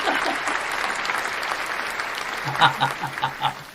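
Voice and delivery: long, breathy laugh; drawn-out voiceless chuckle; wheezy laugh. Real, not acted. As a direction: laugh at your own joke in a long wheeze. Laughs at own old-age joke.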